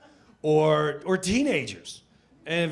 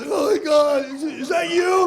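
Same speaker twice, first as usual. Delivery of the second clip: panicked shout; 11 semitones higher, 9 dB louder than usual. Retold or imitated.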